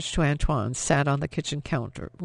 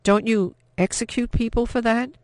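alarmed; 7 semitones higher, 3 dB louder than usual. A narrator's reading. Frightened question to captain.

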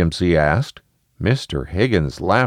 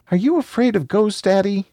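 put-on high-pitched little kid question; high, questioning child voice; 13 semitones higher than usual. A narrator's reading. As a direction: ask like a doubtful young child.

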